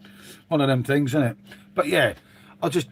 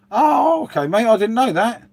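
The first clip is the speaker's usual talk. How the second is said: sudden high, loud gasp of surprise; genuine surprise; 8 semitones higher, 4 dB louder than usual. Real, not acted. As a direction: caught off guard, real shock. Reading surprising chat comment.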